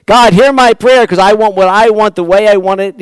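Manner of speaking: loud, entitled whine at God; selfish demanding prayer. Retold or imitated. Preacher mocking a believer's selfish prayer, loud and entitled. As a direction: demand your own way, loudly.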